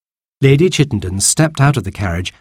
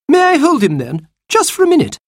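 much higher sweet-talking lady voice; Lady Chittenden cooing at the baby, much higher; grand lady gushing. Acted. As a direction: coo over the baby like a fine lady. Lady asks again.

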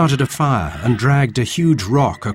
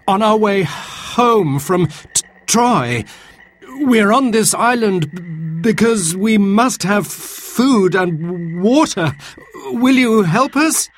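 high-pitched, halting nervous plea; frightened stammer; 10 semitones higher than usual. Acted. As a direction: plead with the monster, stuttering in fear. Frightened sailor pleads with monster.

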